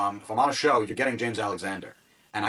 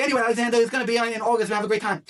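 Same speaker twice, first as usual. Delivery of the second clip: breezy Charlie impression; 13 semitones higher, 4 dB louder, faster than usual.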